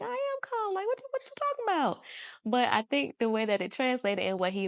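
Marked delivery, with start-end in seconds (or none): 0.0-2.0 high, squealed comic protest; mock indignant. Reacting to "just calm down" at home, mock indignant. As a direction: protest in a hammed-up high voice, rushing the end.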